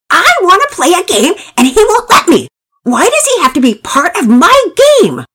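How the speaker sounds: put-on high, loud child's screamed protest; tantrum. A narrator's reading. Pablo protests to Daddy about sharing his game, screaming tantrum. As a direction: wail the complaint at full pitch like a small boy.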